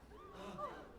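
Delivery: sudden shocked intake of breath; sharp gasp. Real, not acted. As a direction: gasp in disbelief. Player reacts to the game scene.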